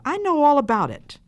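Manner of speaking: put-on high, insistent child; protesting cry. A narrator's reading. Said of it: "cried the child".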